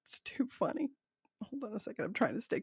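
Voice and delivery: soft whisper. Real, not acted. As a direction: breathe it out, amused. Giggling aside.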